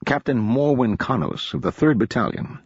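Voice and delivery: ordinary delivery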